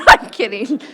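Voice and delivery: loud burst of laughter on the words; laughing. Real, not acted. Chair admitting the joke to the room, laughing loudly. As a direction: laugh out loud while taking it back. Joking after mock-serious remark, laughing.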